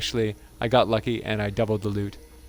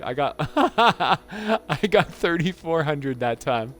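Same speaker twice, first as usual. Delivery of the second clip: slow, high words broken by laughter; laughing through it; 5 semitones higher, much slower than usual. Real, not acted. Gamer celebrating thirty-four hundred metal, laughing through the words. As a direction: laugh out the number in delight.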